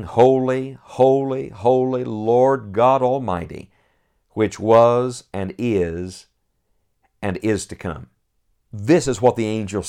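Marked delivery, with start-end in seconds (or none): 0.0-8.1 slow, reverent reading; solemn scripture reading. Acted. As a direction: let each word land slowly. First reading of verse.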